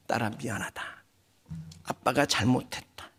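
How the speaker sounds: low, soft retold apology; hushed apology. Preacher voices father kneeling before his daughter, hushed apology. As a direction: imitate a humbled father, voice dropped.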